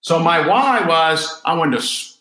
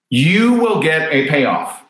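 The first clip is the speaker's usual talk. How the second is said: normal talking voice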